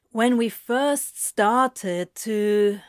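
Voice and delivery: slow, leading half-question; trailing off meaningfully. A narrator's reading. Demon hints at their shared past, trailing off meaningfully. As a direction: draw it out and leave it hanging. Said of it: "he trails off meaningfully".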